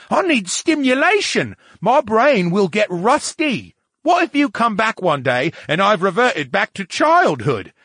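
loud, high, worked-up tirade; high-pitched huffy protest; indignant talking brain. Acted. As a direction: rant in an offended character voice.

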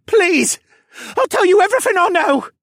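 very high, loud begging; sniveling captive's shrill wail; whining plea. A narrator's reading. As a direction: beg frantically for your life. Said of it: "the man whined".

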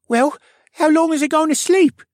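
put-on a lively boy impatient for his friend to wake; youthful stable boy; young boy's voice. A narrator's reading. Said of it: "A voice the color of new saplings".

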